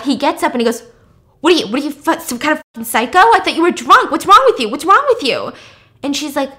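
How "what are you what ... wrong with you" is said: loud, higher mock-angry yelling; retold outburst, shouted and rising; freaking out. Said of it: "And he freaks out".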